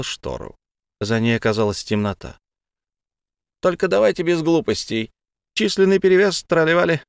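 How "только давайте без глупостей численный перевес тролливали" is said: bossy pixie voice. A narrator's reading. Fairy warns guests.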